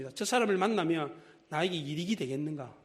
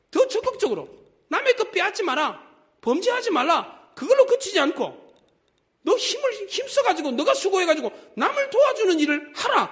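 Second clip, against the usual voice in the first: higher and louder sermon call; fervent preaching; 12 semitones higher, 6 dB louder than usual. Acted. Preacher urges the thief to do good, fervent. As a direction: drive the command home to the congregation.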